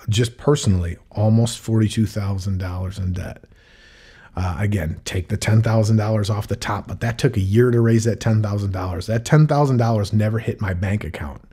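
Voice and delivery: normal talking voice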